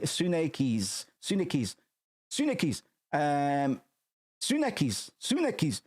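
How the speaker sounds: Tsunekis tried several times, rising in pitch; trying the name. Shown as a demonstration. Stumbling on a name while reading aloud, retrying it. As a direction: test out Tsunekis again and again. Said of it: "repeating the name to get it".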